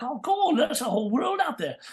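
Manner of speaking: high, loud burst of discovery; acted wonder. Traveler discovering other accents, acted excited wonder. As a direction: marvel like an amazed young traveler. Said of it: "suddenly it's like".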